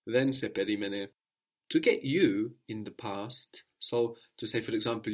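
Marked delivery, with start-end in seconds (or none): none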